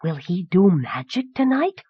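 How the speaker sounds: put-on wondering little boy voice; awestruck. A narrator's reading. Boy asking whether the sorcerer will do magic, awestruck. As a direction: ask like a wide-eyed child. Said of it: "Tolo had gone wide-eyed with awe".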